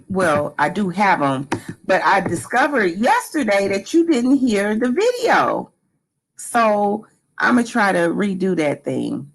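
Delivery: usual speaking voice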